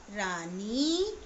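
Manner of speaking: Rani drawn out slowly with a rising tune; slow blending. Shown as a demonstration. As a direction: stretch each sound of Rani. Teacher blending syllables slowly. (रानी को धीरे-धीरे खींचकर पढ़ना)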